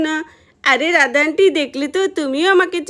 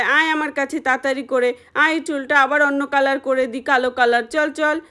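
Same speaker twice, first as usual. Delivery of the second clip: ordinary talk, nothing marked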